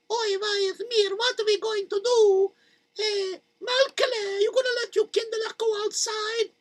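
high, thin worried mother voice; fretting mother. Acted. Mother asking Malkele about the kids, high and fretting. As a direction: fret like a mother scared for her kids.

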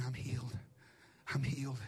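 hushed, low imitation of a lost voice; voiceless whisper. Woman over there repeating her declaration, nearly whispering. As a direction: say it with hardly any voice. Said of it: "able to speak above a whisper".

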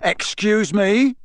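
high, slow disbelief; can't believe what he heard; incredulous. A narrator's reading. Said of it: "said Mister Diggory incredulously".

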